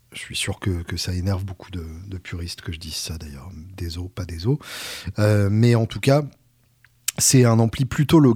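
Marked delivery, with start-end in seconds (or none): 0.1-4.6 quiet, lower aside trailing toward a whisper; hushed aside. Real, not acted. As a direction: drop your voice for a sly non-apology. Aside teasing purists, mock apology.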